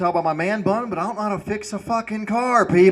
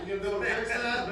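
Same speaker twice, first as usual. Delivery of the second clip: much softer, higher plea; quiet mock question; 6 semitones higher, 10 dB quieter, faster than usual. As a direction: ask it timidly. Asking a stranger quietly.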